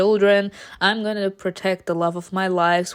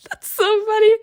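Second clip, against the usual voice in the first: high squeak from laughing; squealing with amusement; real amusement; 12 semitones higher, 4 dB louder than usual. Real, not acted. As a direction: say it still cracking up. Cracking up at own imitation.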